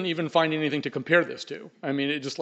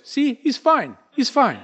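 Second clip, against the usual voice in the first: much higher, loud, pleased declaration; relieved friend; 10 semitones higher, 5 dB louder, slower than usual. Acted. Friend concluding the ghost is fine, high and pleased. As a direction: announce it with comic satisfaction, high.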